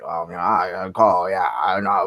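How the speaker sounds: low, goofy put-on caller voice; scam-baiter character voice. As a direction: drop the voice low and play dumb. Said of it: "you're like this".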